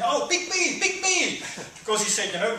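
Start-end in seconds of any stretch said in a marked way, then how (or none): 0.0-1.4 high squeaky pleading voice; eager prisoner. Retold or imitated. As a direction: beg like a kid in class. Prisoners begging the guards.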